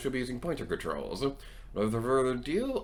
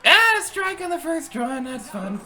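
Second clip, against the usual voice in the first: high loud delighted cry; excited cheer; 8 dB louder, slower than usual. Real, not acted. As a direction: celebrate the strike. Bowls a strike.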